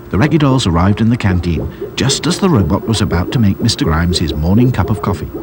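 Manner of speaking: ordinary delivery, nothing marked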